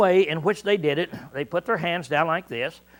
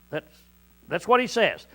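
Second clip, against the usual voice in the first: usual speaking voice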